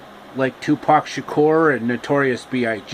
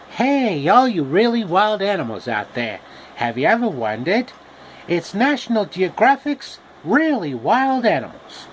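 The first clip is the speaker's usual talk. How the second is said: high, showy nature-show host; 5 semitones higher than usual. Acted. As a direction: pitch it like a kids' wildlife show host. Raccoon doing TV host bit.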